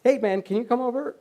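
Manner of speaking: high-pitched puzzled call to the waiter; mildly baffled, voice lifted; perplexed. Retold or imitated. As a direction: imitate a perplexed diner asking someone over. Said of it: "very perplexed".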